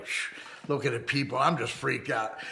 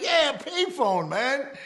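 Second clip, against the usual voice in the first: eager insistence; 4 dB louder, much slower than usual. Retold or imitated. Insisting on payphone to stranger.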